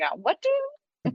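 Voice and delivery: slow, high, drawn-out Boston confusion; mock baffled local asking what; confused local. Friend describing parents' plans, answering in a mock baffled drawl. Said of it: "I'm like".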